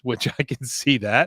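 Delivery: ordinary talk, nothing marked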